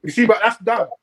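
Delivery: talking with food in mouth; mouth full. Real, not acted. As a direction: speak through a mouthful of food. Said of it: "Sorry, I got food in my mouth".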